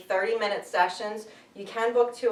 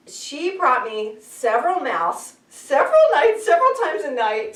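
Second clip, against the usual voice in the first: exasperated; 7 semitones higher, 7 dB louder than usual. Real, not acted. Exasperated mouse deliveries.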